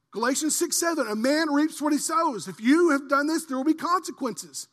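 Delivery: loud, high mock grudge then sermon; full-force preaching. Pastor voicing an unforgiving person, loud preaching. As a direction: drive the point home loudly.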